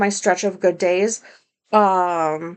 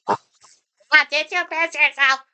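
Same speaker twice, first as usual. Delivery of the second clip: imitating the neighbor; 5 semitones higher, slower than usual. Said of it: "Looking right at me".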